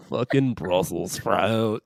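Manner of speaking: drawn-out cartoon line reading; character impression. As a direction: drag each word out low. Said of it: "three different interpretations of that".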